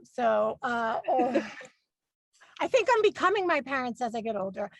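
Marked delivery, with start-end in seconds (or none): none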